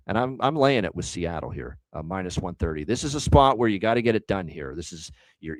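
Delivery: normal talking voice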